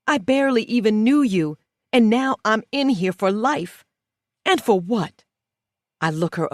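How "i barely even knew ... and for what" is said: louder and higher, rising anger; angry outburst. Acted. Inmate yelling at the woman who framed her, angry and raised. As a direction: demand answers, voice climbing.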